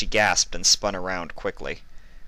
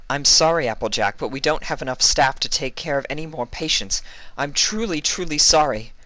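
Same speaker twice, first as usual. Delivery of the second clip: lightly voiced, higher nurse; apologetic nurse; 8 semitones higher, 3 dB louder than usual. A nurse refuses Applejack, lightly voiced and apologetic. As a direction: turn her away gently.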